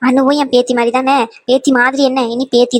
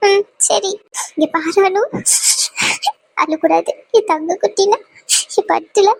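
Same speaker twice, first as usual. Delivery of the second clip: higher-pitched, choked-up pleading; tearful farewell; 6 semitones higher than usual. Acted. Mother sending her newly married daughter off, tearful. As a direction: hold back sobs while soothing the bride.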